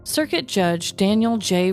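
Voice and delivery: usual speaking voice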